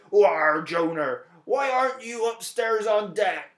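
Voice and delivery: hammy pirate voice, leaning on the "Why"; captain scolding Jonah below deck, mock pirate accent. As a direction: play a storybook pirate captain calling down the stairs. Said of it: "that's what pirates sound like".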